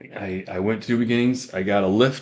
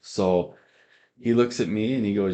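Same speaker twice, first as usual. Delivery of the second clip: ordinary delivery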